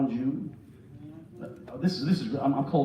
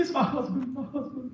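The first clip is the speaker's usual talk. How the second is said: higher, softening imitation of a tearful mother; mock sobbing; 7 semitones higher, 4 dB quieter than usual. Mother confiding in the preacher about her husband, mock sobbing. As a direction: whimper it as if overcome, hammed up. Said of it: "She was crying so vehemently".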